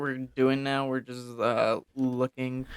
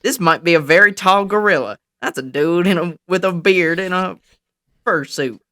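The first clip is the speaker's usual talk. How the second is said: high, loud mock commenter; loud, pitched-up parody of stupid comments; mocking online commenters; 5 semitones higher, 7 dB louder than usual. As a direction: blurt it out like a dumb commenter. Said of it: "most of the comments are just stupid".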